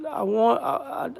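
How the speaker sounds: choking up, the words catch in a real sob; can barely get the word out through real tears; voice breaking. Real, not acted. To his viewers, trying not to cry: voice breaking. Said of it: "starting to tear up".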